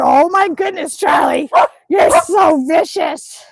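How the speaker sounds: shouting at the dog in mock alarm; loud playful scolding. Real, not acted. Woman scolding her playful dog, loud mock alarm. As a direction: call out loud and high, half laughing.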